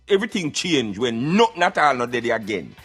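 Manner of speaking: normal talking voice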